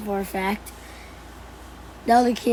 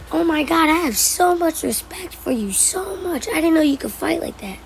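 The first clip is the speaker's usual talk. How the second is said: higher-pitched gushing friend; mock-amazed, raised-pitch retelling; imitated awe; 6 semitones higher than usual. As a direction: gush as an impressed friend would. Friend gushing respect after fight.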